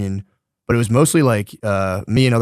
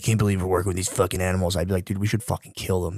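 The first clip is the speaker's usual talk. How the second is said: lowered conspiratorial voice; 5 semitones lower, 7 dB quieter than usual. Retold or imitated. Racist coworker cornering him, escalating.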